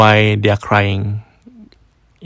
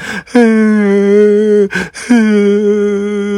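whimpering wail; 10 semitones higher, 3 dB louder than usual. Retold or imitated. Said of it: "the woman started crying macam like".

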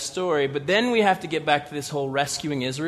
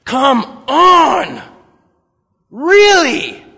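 much higher, loud disbelief; exasperated protest; 8 semitones higher, 10 dB louder, much slower than usual. Acted. Preacher protests God cutting the army, loud disbelief. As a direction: throw up your hands.

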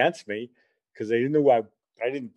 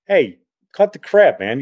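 retold protest; 3 semitones higher, 8 dB louder than usual. Teller to friends hassling Rich, retold protest. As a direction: call them off, raised voice.

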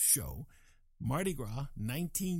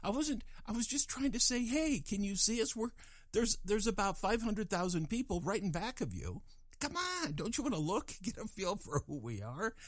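pleading excitedly; 8 semitones higher than usual. Real, not acted. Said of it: "I'm not proud of that".

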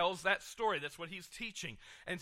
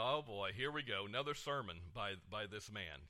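mock grumble; 8 semitones lower, 6 dB quieter than usual. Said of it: "leave grumbling".

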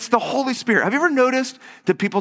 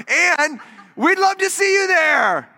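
very high, drawn-out mock welcome; put-on singsong invitation; over-eager invitation; 10 semitones higher, slower than usual. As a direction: gush it slowly, hammed-up. Acting over-eager church invitation.

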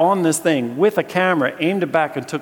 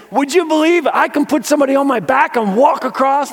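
high, loud rallying call; rally the crowd like a ringmaster; showman challenge; 13 semitones higher, 8 dB louder than usual. Retold or imitated. Walker rallying the crowd, high loud showman call.

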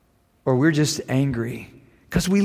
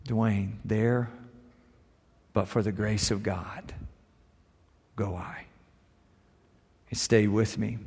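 hushed, heavy-hearted remembering; subdued grief; 7 semitones lower, 9 dB quieter than usual. Real, not acted. Son telling the church his mother just died, grieving. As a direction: speak through fresh loss, holding it together.

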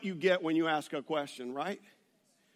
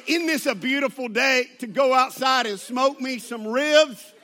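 loud, raised, gleeful mock exclamation; exuberant retelling; 4 semitones higher, 8 dB louder than usual. Imitating the clerk planning to smoke ribs, exuberant. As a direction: belt it out imitating her enthusiasm. Clerk's innocent reveal acted out.

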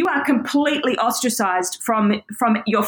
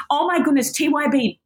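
ordinary talk, nothing marked

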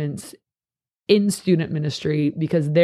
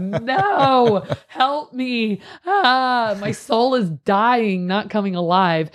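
higher, pleading mock despair; hammed-up despair; 6 semitones higher, much slower than usual. Recalling helping with three-year-olds at nineteen, mock despair. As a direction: ham up a young helper drowning among toddlers. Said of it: "I was like".

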